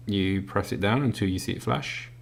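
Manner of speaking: normal talking voice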